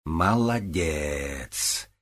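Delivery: very slow, drawn-out sarcasm; venomous drawl. A narrator's reading. Olga mocks a clumsy apology, venomous drawl. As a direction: stretch the praise out poisonously. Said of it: "Ольга ядовито протянула".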